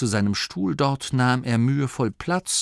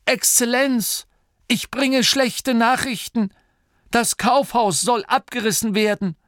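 much higher, louder formal announcement; 5 dB louder than usual. Acted. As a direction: raise your voice to announce bad news. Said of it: "Und lauter".

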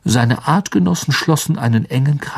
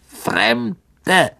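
high, alien spider voice; clicking spider; 8 semitones higher than usual. A narrator's reading. Spider reports strangers, high creature voice. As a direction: hiss it like a wary insect. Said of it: "klickte die Spinne".

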